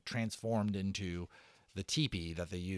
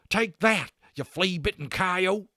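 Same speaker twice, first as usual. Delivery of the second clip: high, loud cowboy shout; furious cowboy bellow; cowboy yell; 11 semitones higher, 12 dB louder, slower than usual. Acted. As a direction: yell it while firing into the dark. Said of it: "he yelled".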